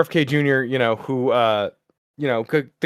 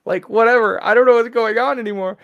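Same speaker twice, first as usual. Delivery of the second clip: higher, fast, fed-up whatever; exasperated; 9 semitones higher, 5 dB louder than usual. Acted. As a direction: give up on making sense of it. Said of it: "she goes".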